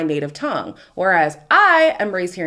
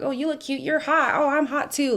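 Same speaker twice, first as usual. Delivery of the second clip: airy, higher mock flirt, a bit softer; mocking shallow flirting; 7 semitones higher, 3 dB quieter than usual. Vlogger mocking shallow islander small talk, light parody. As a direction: parody empty compliments in a lilting voice. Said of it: "deeper than just the surface stuff".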